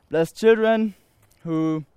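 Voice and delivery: normal talking voice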